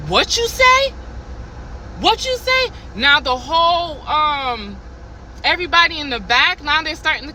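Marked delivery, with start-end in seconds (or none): 0.1-2.7 angry demand. Retold or imitated. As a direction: bark it back at her, much higher. Manager snaps back at her.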